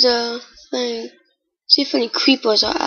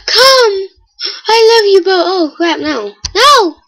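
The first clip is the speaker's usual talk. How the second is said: high, loud shrieks with laughter and crying; half-laughing panic, shriek it high; 7 semitones higher, 5 dB louder than usual. Real, not acted. Kid shrieks at a mob coming toward him, laughing panic.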